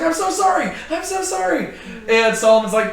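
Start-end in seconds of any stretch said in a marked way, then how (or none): 0.0-1.7 much higher pleading whine; groveling apology. Retold or imitated. Adonijah begging Solomon at the altar, high pleading whine. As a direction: beg for mercy at the altar. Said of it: "He's like".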